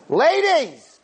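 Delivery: loud, sharp high Ladies!; loud call. Shown as a demonstration. The curator addressing the two ladies, the wrong loud way. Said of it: "Not like that".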